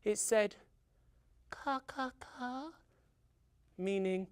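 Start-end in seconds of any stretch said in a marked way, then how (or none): 1.5-2.7 high, soft little caws; tiny newborn crow cawing softly; baby crow. A narrator's reading. Newly hatched crow greeting the world, soft high caws. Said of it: "came a little baby crow".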